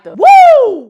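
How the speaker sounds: high, loud hype cry; sudden high loud cheer; excited whoop. Shown as a demonstration. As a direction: let out a big woo. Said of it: "how to take your flow from sounding".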